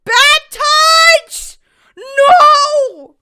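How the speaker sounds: very high, loud shriek of protest; shrieking bad touch. Real, not acted. Streamer shrieks at the game scene in shocked protest. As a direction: shriek it in outrage.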